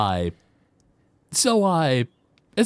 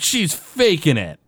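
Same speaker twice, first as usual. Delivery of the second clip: higher-pitched bratty protest, lightly acted; mock the indignant complaint of a jealous brother; negative accusation; 6 semitones higher than usual. Bart protests his sister staying home, negative and indignant. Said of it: "Bart does it in a very negative way".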